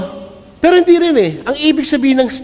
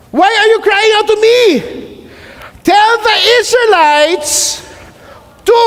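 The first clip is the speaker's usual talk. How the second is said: God's booming voice; 11 semitones higher than usual. Acted. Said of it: "Then the Lord said to Moses".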